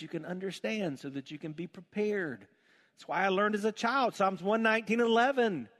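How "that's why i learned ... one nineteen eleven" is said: loud, high preaching at full force. Acted. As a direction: preach it out to the whole church.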